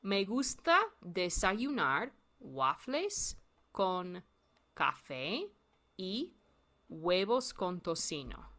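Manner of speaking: whole sentence repeated slowly; slow model sentence for learners. Shown as a demonstration. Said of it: "I'm gonna say it again".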